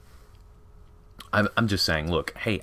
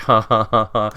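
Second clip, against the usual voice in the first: hammed-up villain cackle, louder; mock evil laugh; 3 semitones higher, 6 dB louder than usual. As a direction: gloat with a staged ha ha ha. Sisters laugh after gloating.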